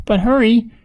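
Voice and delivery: high-pitched urging; urgent farmer's wife. A narrator's reading. Farmer's wife hurries the search for lost pig, urgent. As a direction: press them on, pitched well up.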